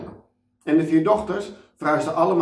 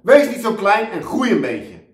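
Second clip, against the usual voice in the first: higher, louder bossy yell; shouting; 9 semitones higher, 7 dB louder than usual. Acted. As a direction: bark it at small children. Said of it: "Of tegen kleine kinderen".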